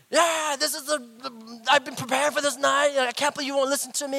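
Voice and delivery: high, loud angry rant; retold yelling. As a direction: rant like an exasperated dad. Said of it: "I was, like, yelling at her".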